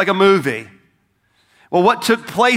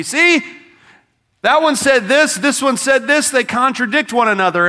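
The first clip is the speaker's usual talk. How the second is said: higher-pitched mimicry of triumphant skeptics; lightly mocking, high-pitched accusation; mock gotcha; 7 semitones higher, 3 dB louder than usual. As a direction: imitate a critic pouncing on contradictions. Mimics skeptics pointing out contradictions.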